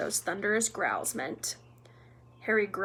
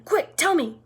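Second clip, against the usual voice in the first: loud, higher-pitched order; urgent demand; 6 semitones higher, 7 dB louder than usual. A narrator's reading. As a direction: press hard for an answer. Filch urgently questioning Peeves.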